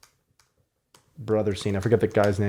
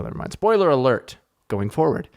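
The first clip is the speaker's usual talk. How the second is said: ordinary delivery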